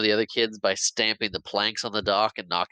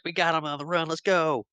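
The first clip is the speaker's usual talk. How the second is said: excited rally; 8 semitones higher than usual. Retold or imitated. Kid rallying against fleeing pirates.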